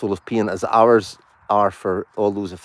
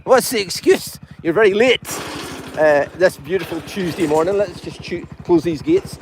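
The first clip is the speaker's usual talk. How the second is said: excited host; 12 semitones higher, 5 dB louder than usual. Acted. High-pitched live show greeting viewers.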